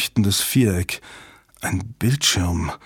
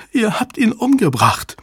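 very high, anguished cry; distraught accusation; 12 semitones higher than usual. A narrator's reading. Susan accuses them of killing her lover, distraught. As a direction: cry out the accusation in one run. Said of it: "stammelte sie".

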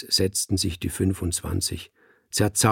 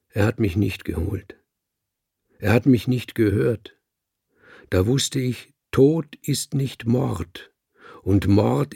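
sonorous speech. Acted. The speaker addresses the cats, sonorous and solemn. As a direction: hold the voice full and sonorous, like an orator. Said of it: "hielt meine Stimme sonor".

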